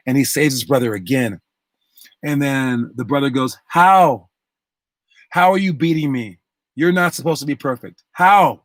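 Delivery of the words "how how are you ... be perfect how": loud, high outburst on each HOW; incredulous demand. Retold or imitated. Beaten brother demands answers from his rescuer, incredulous. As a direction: act out a beaten rival's disbelief.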